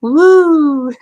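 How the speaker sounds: high, loud cheer; delighted woo. Real, not acted. Vlogger admiring her hat for viewers, excited woo. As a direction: let out a happy whoop.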